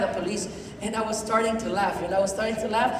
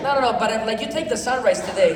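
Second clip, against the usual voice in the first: usual speaking voice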